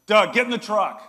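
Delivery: loud barked command again; yelled order. Retold or imitated. Outfitter summoning Doug to the truck a second time. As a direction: call him over with a sharp shout.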